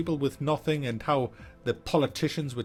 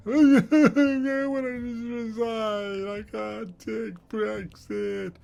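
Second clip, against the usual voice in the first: higher, slow mock crying; mock blubbing; 8 semitones higher, much slower than usual. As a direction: whimper it like a blubbing schoolboy. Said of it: "after Cameron blubbed".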